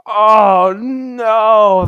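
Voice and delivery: loud, high "Oh, no!" in shocked dismay; shocked cry. Retold or imitated. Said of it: "And I was just like".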